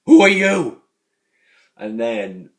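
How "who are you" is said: much higher and louder demand; hammed-up senile yell; confused old man shout. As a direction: bark it at a stranger. Jack's confused reply in retelling.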